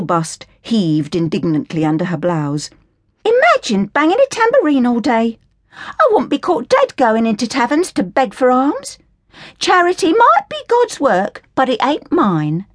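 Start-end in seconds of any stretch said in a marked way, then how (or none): 3.2-12.7 put-on higher, scornful working-class girl voice; indignant Cockney orphan girl. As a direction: sneer at the Sally Army in a higher, cheeky voice. Hilda mocks the Salvation Army.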